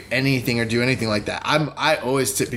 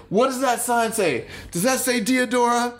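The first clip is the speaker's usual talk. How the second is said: high, exaggerated taunting question with a laugh; mocking question; 10 semitones higher than usual. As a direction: rub it in like talking to a child. Mocking driver with street sign.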